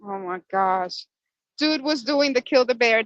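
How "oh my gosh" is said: ordinary delivery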